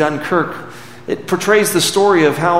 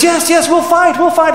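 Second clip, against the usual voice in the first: high, loud chorus of resolve; rally the crowd's loud cry; crowd shouting; 12 semitones higher, 4 dB louder than usual. Retold or imitated. Passengers answer Churchill together, loud and rallying. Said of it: "everyone says".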